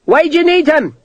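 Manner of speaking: captain calling out; called out. A narrator's reading. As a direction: shout the question from a distance. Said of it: "a voice called".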